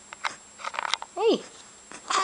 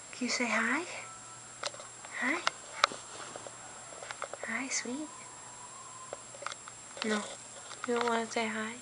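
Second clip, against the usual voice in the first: whispering; 2 semitones lower, 13 dB quieter, faster than usual. Real, not acted. Whispered greeting to baby.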